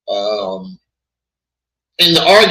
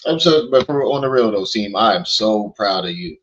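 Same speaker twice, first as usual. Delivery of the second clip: usual speaking voice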